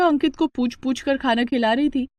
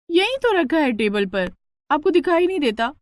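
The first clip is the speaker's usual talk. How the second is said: higher, irritated retort; annoyed; 6 semitones higher than usual. Acted. Priyanka to her father about the newspaper, annoyed. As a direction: snap at your father for interrupting. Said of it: "तो इतना गुस्सा क्यों कर रही है".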